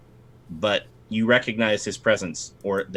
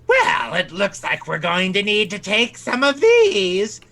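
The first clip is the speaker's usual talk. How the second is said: menacing monster mother; 8 semitones higher, 5 dB louder than usual. Acted. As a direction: play the clawed mother savoring her threat. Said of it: "your mother crawls up".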